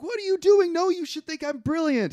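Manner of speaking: mock outrage, very high and loud; indignant outburst. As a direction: shriek it like a wounded artist. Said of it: "And he's like".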